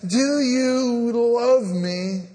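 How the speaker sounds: Jesus asking Peter tenderly, drawn out slowly; tender question. Acted. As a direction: ask it gently, like a father to a hurting son. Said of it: "oh, so tenderly telling him this".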